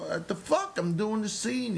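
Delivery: high, indignant retort; swearing back. Retold or imitated. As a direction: swear back at the teacher, mock outrage. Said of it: "I'm swearing back at him".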